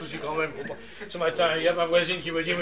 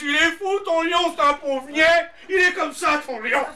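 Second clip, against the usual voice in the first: high, loud, slow mock neighbour voice; 10 semitones higher, 5 dB louder, slower than usual. Neighbours complain about the crazy lion, high mock voice. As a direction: drag it out like a scornful neighbour. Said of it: "les voisins disent".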